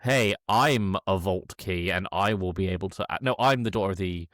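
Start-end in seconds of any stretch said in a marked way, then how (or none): none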